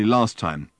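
usual speaking voice

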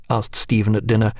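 ordinary delivery

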